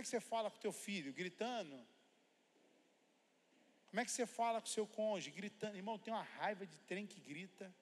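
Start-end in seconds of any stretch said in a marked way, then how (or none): none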